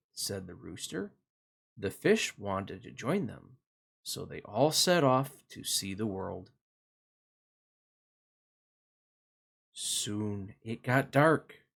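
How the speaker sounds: put-on gentle storybook narration for kids; reading to children. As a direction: read it like a bedtime picture book. Reads picture book to children.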